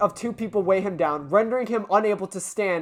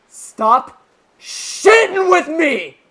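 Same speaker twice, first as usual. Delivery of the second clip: higher, louder and dragged out slowly; furious refusal; 9 semitones higher, 10 dB louder, much slower than usual. Acted. Yagumo refusing Monomi's offer: slow furious shout. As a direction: spit out each word slowly in rage.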